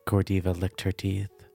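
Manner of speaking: plain reading voice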